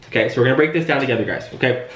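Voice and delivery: ordinary delivery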